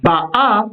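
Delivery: Ba'a stressed on its last syllable; milra stress. Shown as a demonstration. Teacher shows milra for the present, final-stressed Ba'a. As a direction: lengthen and lift the final A of Ba'a. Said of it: "une accentuation milra".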